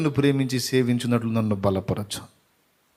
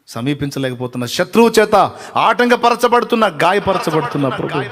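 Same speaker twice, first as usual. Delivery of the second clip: higher, louder pleading in waves; fervent prayer; 4 semitones higher, 6 dB louder than usual. Acted. Preacher pleading with the Lord at full force. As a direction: cry out the struggle to God.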